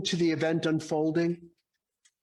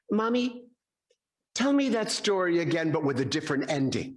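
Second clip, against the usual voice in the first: put-on four-year-old boy; 2 semitones higher, faster than usual. Boy asks his mother to retell the story, childlike. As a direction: plead like a small child asking Mommy. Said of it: "the four-year-old boy listened carefully".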